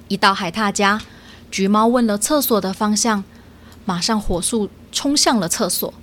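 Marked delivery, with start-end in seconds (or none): none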